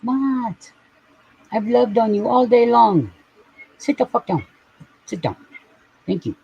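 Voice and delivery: high, exasperated scold at a pet; mock-stern pet scolding; scolding the cat. Real, not acted. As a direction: tell the cat off, half fond.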